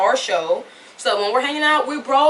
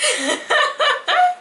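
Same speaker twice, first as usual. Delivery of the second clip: high-pitched helpless giggles; real giggling fit. Real, not acted. Fan giggles over favorite character Rick, high giddy laughing.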